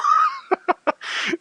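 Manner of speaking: squealing high laugh; high burst of laughter. Real, not acted. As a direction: let out a shrill laugh. Player laughs at the roll.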